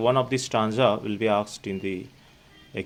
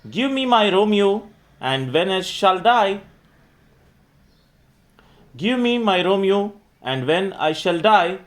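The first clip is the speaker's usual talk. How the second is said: higher, louder verse declamation; poem recitation; 5 semitones higher, 5 dB louder than usual. Acted. Teacher reciting Juliet's plea to night for students, declamatory recitation.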